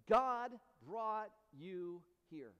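slow, separated words; weighty declaration. Acted. Preacher passing Joshua's words to the congregation, slow and weighty. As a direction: give each word its own weight.

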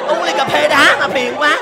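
high, slow shout of annoyance; loud complaint. Acted. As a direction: holler the complaint, drawing it out. Customer complains loudly about being bothered.

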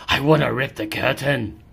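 ordinary delivery

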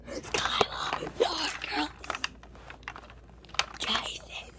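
hushed, urgent calls to the pets; whispering so nobody hears. Real, not acted. Kid hiding, whispering for backup, hushed and urgent.

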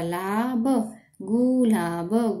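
Gulab drawn out slowly; sounding out slowly. Shown as a demonstration. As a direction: stretch gulab syllable by syllable.

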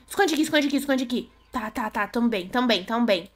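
soft, hushed self-calming; quiet so he won't hear; hiding whisper. Real, not acted. Hiding from the monster.

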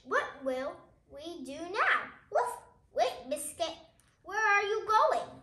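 ordinary delivery, nothing marked

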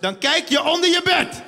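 hint of a gruff dad; stern father. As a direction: brush off the scared child. Father answering child.

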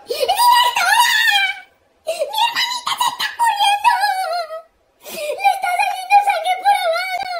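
high, hammed-up tearful panic; hysterical wailing. Boy reports dying sister to principal, hysterical. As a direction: babble the whole disaster in a high wail.

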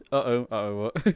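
normal talking voice

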